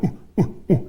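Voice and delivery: short, soft "ooh" puffs. Shown as a demonstration. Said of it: "That's your lower lip. You go".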